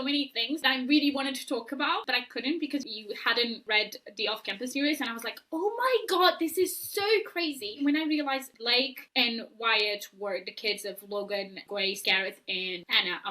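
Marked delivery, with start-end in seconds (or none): 5.5-7.8 retold amazement. Retelling amazed reaction while reading.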